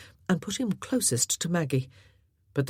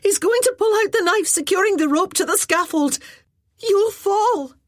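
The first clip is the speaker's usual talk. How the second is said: high, loud panicked warning; frantic warning; 6 dB louder than usual. Acted. Maggie warning Callum the rope will fall, high panicked shout. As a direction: shout the danger before it's too late.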